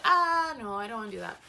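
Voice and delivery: yelp, then back off the idea; loud refusal. Retold or imitated. Refusing the posing picture, a loud yelp then no. Refusing pose before meeting Jeremiah.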